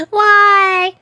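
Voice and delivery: loud, high wail of protest; dismayed outcry. Real, not acted. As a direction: cry it out in dismay. Dismayed reaction to little sleep.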